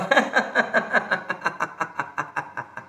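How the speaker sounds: long rhythmic laugh, fading away; released laughter. Shown as a demonstration. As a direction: let the breath out as a long staccato laugh. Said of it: "मोकळं व्हा". (श्वास सोडत लांब हास्य)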